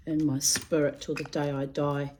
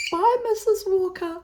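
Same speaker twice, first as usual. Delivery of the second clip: put-on much higher, small child's voice; little girl's goodbye; 5 dB louder than usual. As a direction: chirp it like a timid five-year-old. Child self greeting old neighbour.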